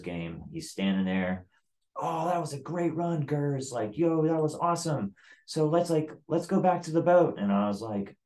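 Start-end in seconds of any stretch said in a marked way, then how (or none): 2.0-7.4 high, put-on congratulatory voice; mock chummy chief. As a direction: imitate a fake-friendly boss, pitched way up. Chief's chummy talk after losing.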